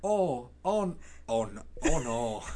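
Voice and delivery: lightly mock Minnesota dismay; drawn-out Minnesotan lament, played for laughs; Minnesota accent. Botched British impression slipping into Minnesota, mock dismay. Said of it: "This is more Minnesota".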